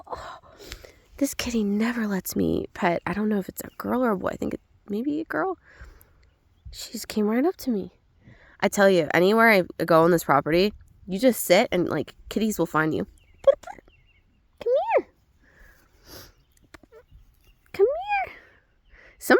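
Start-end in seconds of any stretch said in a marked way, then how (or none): 0.1-3.0 hushed whisper near a shy cat. Real, not acted. 13.5-18.4 very high coaxing call; pet talk. Real, not acted. Calling the cat.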